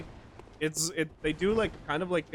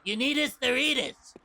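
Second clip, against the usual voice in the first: "You need us, the Reedus" pitched much higher in a put-on odd voice; Norman Reedus impression; 10 semitones higher than usual. Actor walking onto the set greeting everyone, goofy high put-on voice. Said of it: "Why do you sound like that, Norman?".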